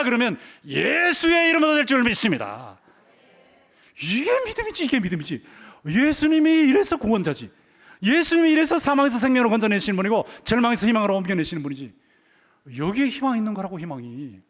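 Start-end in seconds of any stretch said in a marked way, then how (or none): 0.0-12.0 high, loud declaration of faith; full-force preaching. Acted. Preacher proclaiming Jesus as saviour to teachers, high fervent preaching. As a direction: cry it out with conviction, stretching key words.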